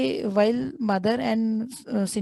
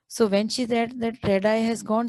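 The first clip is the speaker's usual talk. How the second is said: usual speaking voice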